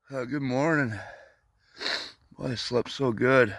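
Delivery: ordinary talk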